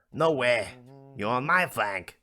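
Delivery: loud, indignant comeback; squabbling over whose flank it is; acted bickering. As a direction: argue back, punching MY.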